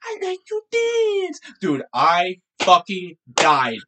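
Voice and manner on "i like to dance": put-on high, drawn-out kid voice; squeaky-high mock child voice; little boy imitation.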